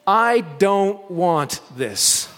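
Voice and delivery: "I don't want this" spelled out slowly, every word weighted; said in all caps. Retold or imitated. Preacher reading the typed caption to the congregation, slow and weighted. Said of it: "in all caps, she put the words".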